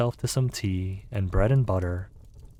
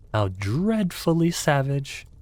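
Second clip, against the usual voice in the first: shocked exclamation; 7 semitones higher, slower than usual. Acted.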